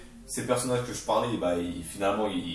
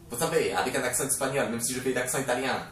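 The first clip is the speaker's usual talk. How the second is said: badly faked Spanish-Italian accent; hammed-up Spanish accent. Shown as a demonstration. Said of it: "je sais pas faire les accents".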